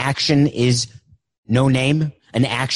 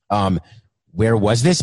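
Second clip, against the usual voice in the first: ordinary talk, nothing marked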